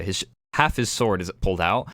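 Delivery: usual speaking voice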